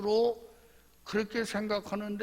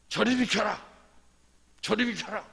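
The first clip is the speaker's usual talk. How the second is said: higher, loud order to clear the way; commanding shout; 4 semitones higher, 6 dB louder than usual. Acted. Preacher as Simon clearing a path to Jesus, commanding shout. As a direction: burst out as if pushing through. Simon imagined pushing through the crowd.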